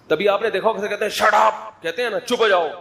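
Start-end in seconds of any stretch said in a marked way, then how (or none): 1.1-1.8 raised, high-pitched mock yell; mock shouting. Parent silencing the children at home, mock shouting. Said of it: "چیخو، چیخ کر۔". 2.2-2.7 high, mildly raised command; mock shouting. Parent loudly hushing the children, mock shouting. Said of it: "زور سے کہتے ہیں؟".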